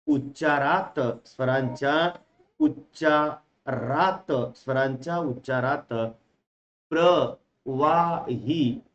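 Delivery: drawn out slow dictation, each word held; slow teacher dictation. Shown as a demonstration. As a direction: stretch every word for note taking. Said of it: "इथे एक standard शब्द वापरला त्यांनी".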